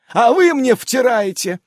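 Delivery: high, loud indignant jab; indignant retort. Acted. Amazon snapping at the spirits, high and loud. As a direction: throw it back at them.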